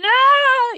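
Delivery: loud, high mock wail; llanto repentino imitado. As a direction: burst into an exaggerated cry. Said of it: "Y lloro". (llanto exagerado, agudo y fuerte)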